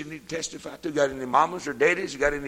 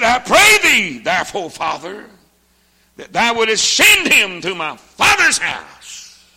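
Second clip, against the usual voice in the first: high, loud, slow chanted plea; preacher crying the rich man's request to Father Abraham; preaching at full force; 9 semitones higher, 14 dB louder, slower than usual. Acted. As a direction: ring out the verse like a desperate prayer.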